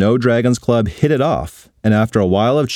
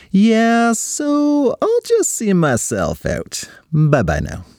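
put-on awkward girl voice; 11 semitones higher, much slower than usual. Awkward exit from party.

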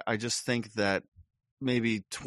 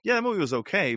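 higher and louder mock dismissive yeah; hammed-up lukewarm verdict, higher and louder; mock public shrug; 10 semitones higher, 5 dB louder, faster than usual. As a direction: toss it off like an unimpressed crowd, pitched up. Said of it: "everyone's like".

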